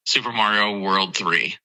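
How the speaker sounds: normal talking voice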